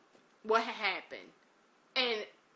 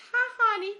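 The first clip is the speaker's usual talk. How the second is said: imitating an old lady's high-pitched greeting; lifted, louder sweet-old-lady hello; little old lady; 12 semitones higher, 8 dB louder than usual.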